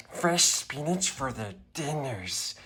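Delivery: ordinary delivery, nothing marked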